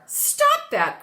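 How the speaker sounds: high, loud alarmed cry. Acted. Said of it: "cried George".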